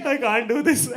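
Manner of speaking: high, loud wail through laughter; hammed-up tearful squeal, breaking into laughs; mock breakdown. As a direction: wail it like a comic meltdown. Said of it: "I broke down even more".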